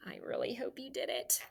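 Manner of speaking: a hushed, hopeful whisper; whispered aside. Real, not acted. Teacher hoping students doubled the G, whispered aside. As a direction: breathe it as a secret hope.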